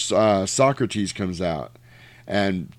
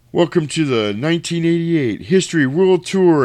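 higher-pitched mock stage welcome; show announcer; 6 semitones higher, 4 dB louder than usual. Acting the show's opening announcement.

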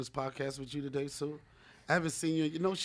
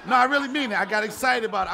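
normal talking voice

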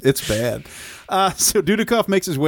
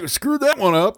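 high mock voice; 8 semitones higher than usual. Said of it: "It's like".

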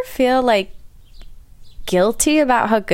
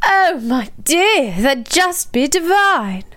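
slow, gushing mock-British reply; British accent; 2 semitones higher, slower than usual. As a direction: drawl it as a delighted mock-British guest. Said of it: "how to say in a British accent".

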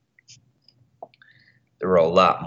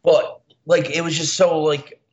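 excited; 9 semitones higher than usual. Real, not acted. Excited reaction after laughing.

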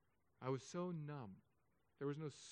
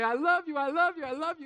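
put-on much higher, louder gushing of children; excited kids; 12 semitones higher, 11 dB louder than usual. Kids running up to a parent, excited loud gush. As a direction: pile it on like adoring kids.

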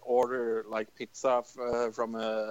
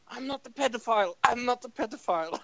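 higher, fast, nervous protest; mock defensive plea; 9 semitones higher, faster than usual. Lone adult at a kids' movie protesting his innocence, fast and jittery. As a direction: rattle it off like a nervous denial. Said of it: "I was like".

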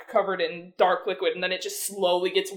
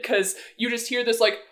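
normal talking voice

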